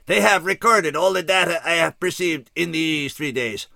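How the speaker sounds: a remorseful alien announcing doom; alien scout. A narrator's reading. Alien explaining his installations.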